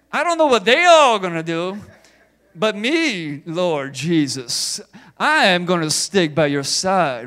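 loud boastful Peter, proud and sure; Peter's boast. Retold or imitated. Preacher voicing Peter's boast to Jesus, loud and proud. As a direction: declare loyalty loudly, chest out. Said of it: "So Peter's like".

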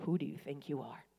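hushed divine reprimand to an angry woman; hushed Holy Spirit rebuke. Retold or imitated. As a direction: whisper it like a holy rebuke from above. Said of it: "and he said".

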